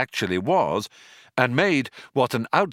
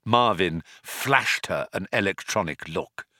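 plain reading voice

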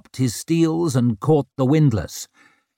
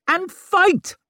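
urgent high voice. Acted. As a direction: push the plan in a high voice. Gorst urging in the fight.

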